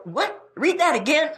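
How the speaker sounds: rising incredulous question; stunned reader, pitch shooting up; mock disbelief. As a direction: pretend you can't believe your ears. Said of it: "you just go".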